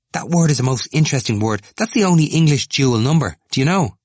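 high, loud, eager explaining; sudden eagerness. A narrator's reading. Temple turning to Stephen about a word, suddenly eager. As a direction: share the discovery excitedly. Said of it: "said with a sudden eagerness".

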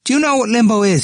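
fast, high, loud challenge; crying out. A narrator's reading. Temple taunting as he moves away, crying out. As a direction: fire the question out high and fast. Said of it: "he cried".

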